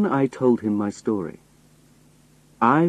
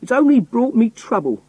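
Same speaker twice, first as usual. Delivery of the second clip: high-pitched, aggrieved outburst; crying out; 14 semitones higher, 4 dB louder than usual. A narrator's reading. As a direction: cry out in protest, pitch way up. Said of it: "I cried".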